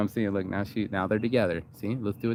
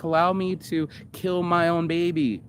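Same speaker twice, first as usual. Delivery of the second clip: higher mock plea; mock pleading; 7 semitones higher than usual. Mocking an imagined plea, higher mock voice. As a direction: beg it with fake sincerity. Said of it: "said no one ever".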